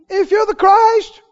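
high, slow scoffing challenge; the thief sneering at Jesus; drawn-out high jeer; mocking tone. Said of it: "Same tone".

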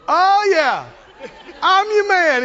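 high, loud, cocky enthusiasm; eager boast. Retold or imitated. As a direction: jump at the offer like an eager volunteer. Acts out David jumping at the reward.

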